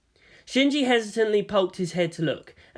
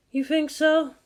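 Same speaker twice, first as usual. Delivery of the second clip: unamused; 11 semitones higher, slower than usual. A narrator's reading.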